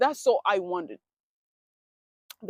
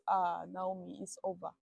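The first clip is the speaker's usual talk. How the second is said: much softer, resigned self-pity; soft mock-tragic resignation; hushed despair; 3 semitones lower, 11 dB quieter than usual. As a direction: tell yourself it's hopeless, quietly. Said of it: "I say".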